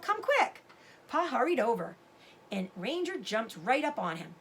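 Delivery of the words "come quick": higher, louder urgent call; boy urging his father to come fast. Acted. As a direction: hurry him over. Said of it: "Sam called".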